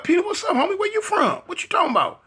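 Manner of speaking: high, incredulous demand; shocked disbelief. Retold or imitated. To Peanut after hearing he switched sets, high shocked disbelief. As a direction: push back in shocked disbelief. Pressing friend about his gang.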